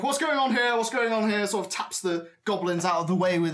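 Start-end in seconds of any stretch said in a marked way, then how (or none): 0.0-1.5 put-on higher, demanding old man's voice; elderly ringmaster. Elderly man walks into the commotion, demanding old ringmaster. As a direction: demand answers as you stride in. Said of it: "He sort of walks over and is like".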